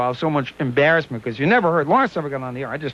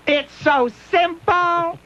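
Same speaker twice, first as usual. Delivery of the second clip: slow, high mock director voice; Dom DeLuise; 11 semitones higher, much slower than usual. Retelling the movie scene, slow high director voice. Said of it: "He says".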